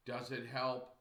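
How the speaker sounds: ordinary delivery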